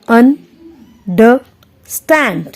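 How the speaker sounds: "Understand" slowly split into three separate syllables; teacher sounding out "understand" piece by piece; syllable-by-syllable demo. Shown as a demonstration. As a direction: break "understand" apart, pausing after each syllable. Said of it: "ये दोनों वीक syllable हैं".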